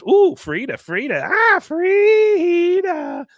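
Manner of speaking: high, long drawn-out sigh of "ah, Frida"; swooning over Frida. Acted. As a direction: melt on the name, let it drag out slow.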